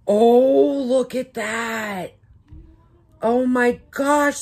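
wowed, drawn-out gushing; thrilled unboxing reaction; real delight. Real, not acted. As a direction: marvel slowly at a gift. Marvelling at a gift unboxed.

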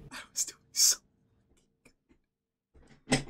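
soft whispered lament. Real, not acted. Gamer lamenting his death, whispered. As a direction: breathe it out defeated. Muttering after dying.

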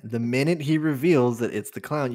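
ordinary talk

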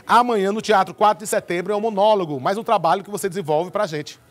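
loud, high presenter voice calling in a guest; TV host introduction. Real, not acted.

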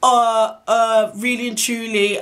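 loud, drawn-out stalling; slow, hesitant retold excuse; mock stuttering. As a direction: imitate him fumbling slowly for an answer. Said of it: "bear stuttering".

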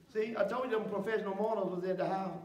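usual speaking voice

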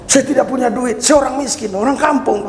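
high-pitched pleading retelling; mock-tearful plea; imitated tearful confession. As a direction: voice a humbled man pouring out his confession, pitched high. Voices weeping police chief's confession.

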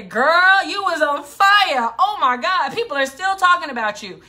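put-on loud, high, excited cry of "Girl"; raving praise. Event planners reply raving, a loud excited shout. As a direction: shout the compliment like an overjoyed fan.

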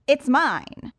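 high, cocky villain voice; Riddler voice. Acted. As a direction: play the smug showman. Riddler tells the punchline.

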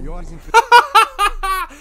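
sudden high loud burst of laughter; real laugh. Real, not acted. Streamer reacting to the DPA joke, loud real laugh. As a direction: cracks up out loud.

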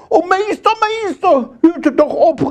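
high-pitched wailing plea; pleading cry. Acted. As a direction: beg the master to stop the beating. Karl cries out while being thrashed.

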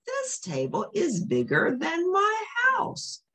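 a tiny mouse wide-eyed at the big table; amazed little mouse. Acted.

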